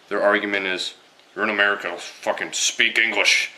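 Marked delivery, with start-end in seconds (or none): 1.3-3.5 lightly acted outrage; mock indignation. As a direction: put on a mock irate demand. Quotes angry people's argument.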